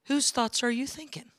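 ordinary delivery

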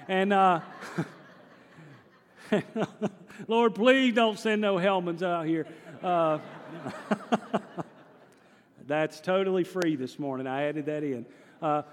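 usual speaking voice

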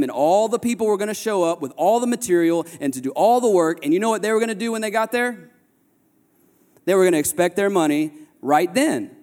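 high, driving sermon voice; rising preacher's fervor; preaching at full force. Acted. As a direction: preach it out to the whole room. Sermon story builds up.

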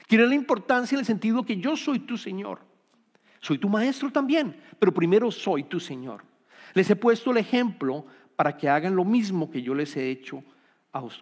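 ordinary delivery

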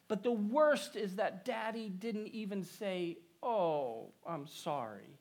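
lightly voiced little girl, slow, starting high; hurt child. A narrator's reading. Daughter telling mommy what daddy didn't say, plaintive child voice. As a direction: complain like a small child, softening at the end. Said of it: "said the child".

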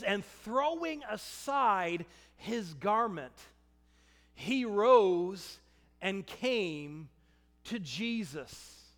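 slow, weighty scripture read; slow emphatic reading. Real, not acted. Preacher reading the key verse, slow and weighty. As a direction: draw each phrase out. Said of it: "this next verse is so important".

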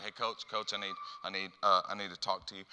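soft, hesitant plea to coach; timid plea. Retold or imitated. Player sheepishly asking his coach to leave, soft. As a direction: sheepishly beg for a word. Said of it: "I'm like".

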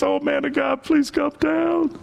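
high, slowed-down begging; timid pleading. Acted. Third commander kneels before Elijah, begging meekly. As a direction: plead in a high, small voice, dragging out the words. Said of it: "He doesn't speak in a big voice".

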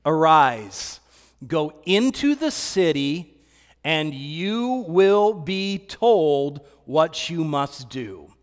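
slow, low, solemn command; measured divine instruction with weighty pauses; the Lord's voice. Retold or imitated. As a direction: speak as God commanding, low and unhurried. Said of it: "the Lord said to him".

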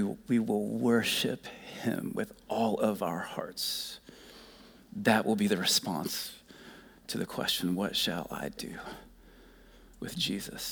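fading to a soft whisper; quiet reverent close; hushed. Real, not acted. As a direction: let it fall away softly. Reverent quieting toward communion.

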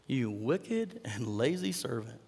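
slow rebuke. Acted. Master condemns lazy servant, slow and heavy. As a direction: spell out the judgment slowly.

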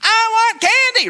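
put-on very high, slow whiny kid; a hint of a screaming child begging; whining child. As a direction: whine like a toddler for candy. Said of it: "a kid is screaming their heads off".

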